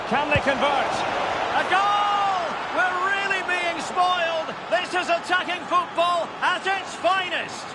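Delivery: high, ringing commentator shout as the ball goes in; excited goal call. Acted. Commentator calling a goal to viewers, excited high shout. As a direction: bellow the goal to the crowd.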